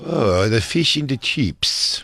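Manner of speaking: slow, put-on Chinese accent; hammed-up Chinese accent; mock Chinese accent. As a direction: order food in an exaggerated Chinese accent. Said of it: "I like her more Chinese".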